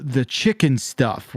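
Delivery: ordinary talk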